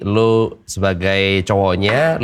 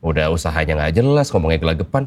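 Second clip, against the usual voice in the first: ordinary talk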